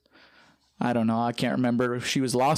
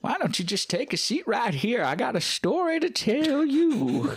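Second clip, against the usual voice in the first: put-on creepy old man; 10 semitones higher than usual. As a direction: play the spooky old prospector. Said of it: "Creepy old man".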